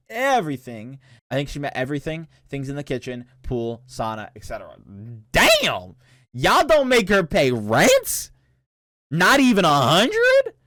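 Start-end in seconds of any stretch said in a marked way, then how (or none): 5.3-10.5 loud, high, drawn-out disbelief; incredulous. Real, not acted. Streamer reacting to a friend living rent-free, loudly incredulous. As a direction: exclaim it in stretched-out shock.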